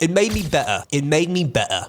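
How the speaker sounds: put-on British accent, dropped r's; mock British accent quote. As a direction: imitate an athlete in a British accent. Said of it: "In his nice British accent".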